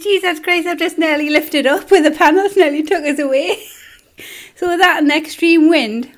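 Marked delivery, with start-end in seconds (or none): 0.0-3.7 high, loud retelling of a near-miss; alarmed exclamation. Retold or imitated. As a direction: blurt it out, shocked and laughing. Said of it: "He says".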